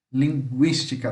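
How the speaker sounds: linguística with the u sounded as gw; linguística as if the u had a trema; pronounce with umlaut. Shown as a demonstration. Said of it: "Como se tivesse uma trema aqui". (linguística com o u soando, como se tivesse trema)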